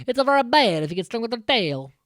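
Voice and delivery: put-on Italian accent. Alpha warning about Scorpina's sting, quick mock Italian accent. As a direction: rattle it off in a comic Italian accent. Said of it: "I don't know why I went Italian with that".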